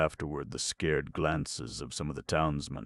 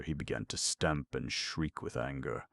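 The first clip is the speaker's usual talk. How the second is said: ordinary delivery, nothing marked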